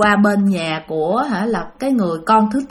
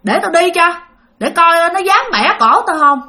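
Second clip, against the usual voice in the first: put-on defiant old man; 6 semitones higher, 3 dB louder than usual. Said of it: "ông mới nói rằng là".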